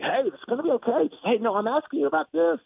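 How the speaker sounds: high, light soothing reassurance; a hint of calming a volatile coach. Reporter lightly voices soothing the angry coach down. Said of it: "talk him off the ledge".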